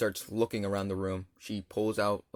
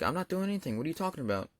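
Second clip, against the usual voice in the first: higher-pitched mock innocence; sister's denial; 5 semitones higher, 4 dB quieter, faster than usual. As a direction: imitate a sister protesting, pitched up and softer. Sister denies accusation again.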